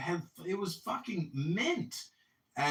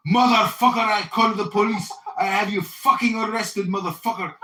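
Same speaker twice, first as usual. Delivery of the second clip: louder, angry yelling buyer with a laugh; hammed-up threat to call the police; mock furious shout; 5 semitones higher, 9 dB louder than usual. The Persian buyer threatening him, mock furious shout. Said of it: "And he goes".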